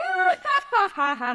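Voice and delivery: dramatic cackle. Retold or imitated. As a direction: cackle big and theatrical. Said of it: "just laughing so dramatically".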